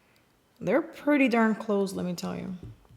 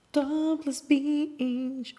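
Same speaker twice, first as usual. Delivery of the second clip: "Topless Beach" stretched very slowly and higher; drawn-out repeat; 5 semitones higher, much slower than usual. Real, not acted. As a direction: linger on each vowel of "Topless Beach". Name repeated in drawn-out delivery.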